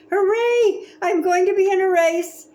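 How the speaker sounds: high, loud joyful shout; thrilled cheer. Acted. Kid told he can race at last, shouting with joy. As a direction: burst out with joy.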